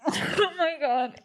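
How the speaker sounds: tearful, high-pitched apology with nervous laughs; real crying, words stretched and breaking; getting emotional. Real, not acted. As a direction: choke up and apologize through tears. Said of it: "I'm getting emotional".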